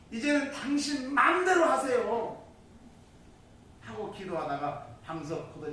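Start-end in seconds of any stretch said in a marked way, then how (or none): 0.1-2.4 high, loud fed-up prayer; acted-out frustration thrown at God; exasperated giving up. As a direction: give up on God, loudly. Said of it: "실망이 되고 낙담이 되는지 그때 하는 말이".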